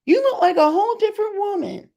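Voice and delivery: high, astonished disbelief; shocked exclamation. Retold or imitated. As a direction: gasp it out like you barely recognize her. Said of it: "I'm like".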